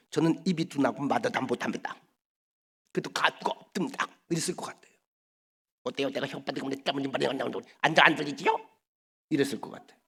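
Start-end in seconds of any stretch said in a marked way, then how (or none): 0.1-2.0 lisping, slushy sh for s, softer. Retold or imitated. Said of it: "갑자기 혀 짧은 소리로". 5.8-8.6 fast mock lisp, tongue-tied. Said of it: "갑자기 혀 짧은 소리로".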